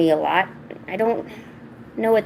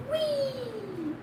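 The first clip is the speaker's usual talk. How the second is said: high, soft, drawn-out squeal; tiny airborne whee for a pet; playful soft whee; 13 dB quieter than usual. Real, not acted. Lifting the cat up playfully.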